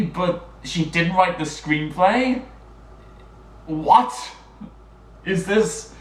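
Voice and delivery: hammed-up astonishment at a shocking discovery; mock disbelief. Vlogger reacting to a change in the writing credits, mock disbelief.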